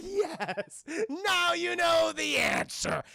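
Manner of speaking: high, loud, slow gloating scream; mock villain shout. Scarecrow gloating over his victim, high slow shout. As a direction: howl it like a monster gloating.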